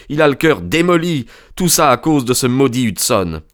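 put-on high, impassioned young man's outburst; agitated young friend. A narrator's reading. Son blaming the sailor for his dying father, agitated and high. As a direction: pour out the grievance in a high, urgent voice.

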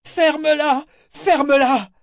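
very high, loud screamed order; hysterical shriek. A narrator's reading. Eta screams at Althea to stop, hysterical. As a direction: scream it twice, losing control. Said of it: "La voix d'Eta monta, suraiguë, hystérique".

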